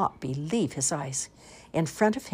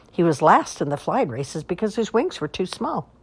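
ordinary delivery

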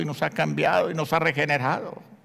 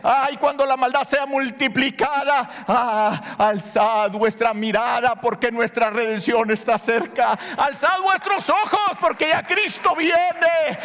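building from steady reading to a high ringing call; preaching; 7 semitones higher than usual. Acted. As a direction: build the prophecy up to a fervent climax. Preacher reads scripture, building. (predicación que crece hasta un clamor agudo)

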